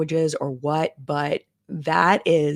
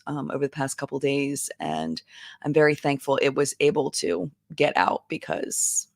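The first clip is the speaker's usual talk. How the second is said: normal talking voice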